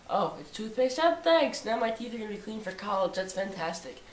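high, overdone mock gratitude for a dull gift; sarcastic thanks. Teen thanking mom for toothpaste, mock delight. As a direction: gush fake thanks, clearly not meaning it.

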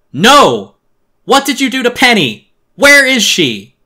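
high, full-volume furious demand; desperate shouting. Acted. Boy demanding answers about missing Penny, desperate shouting. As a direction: scream the questions, drawing out the last.

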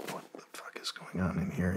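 soft whisper of bewilderment; whispered disbelief. Retold or imitated. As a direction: breathe it out, stunned. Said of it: "We're just like".

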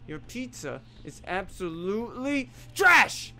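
mock outrage. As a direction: shout it like a furious critic. Ranting at pizza.

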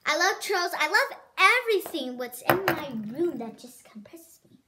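ordinary talk

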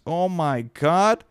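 slow, high and loud oh my God; alarmed as the cat nears the lens; alarmed gasp. Real, not acted. Cat about to knock camera.

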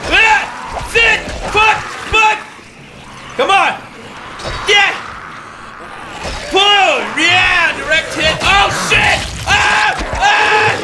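high, full-force bursts of yelling; battle shouting. Real, not acted. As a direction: shout with each swing at the enemy. Shouting through a zombie brawl.

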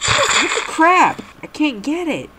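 high frustrated whine; frustrated squeal. Real, not acted. Fails the level again.

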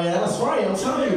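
ordinary talk, nothing marked

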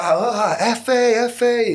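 high-pitched excited call to a mate. Retold or imitated. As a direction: re-enact hailing a friend from a cell, pitched well up. Calling out to mate in cells.

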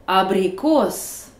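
"abricos" slowly, abriCOS; stress on last syllable. Shown as a demonstration. Teacher showing stress on a real word, "abricos" stressed at the end. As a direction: lean hard and long on the COS of "abricos". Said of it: "voici comment ça sonne avec de vrais mots".

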